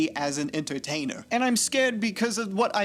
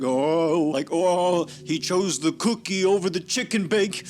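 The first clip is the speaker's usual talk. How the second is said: imitating overblown hype, loud; loud mock awe, as if it were huge; hyped-up; 4 dB louder, slower than usual. Imitating people hyping the Rizzler's cookie choice, loud mock hype. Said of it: "like, hyping it up".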